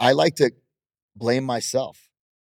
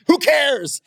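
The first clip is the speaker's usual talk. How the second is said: exasperated who cares; 4 dB louder than usual. Acted. Mocking wife deleting posts.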